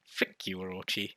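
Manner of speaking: ordinary delivery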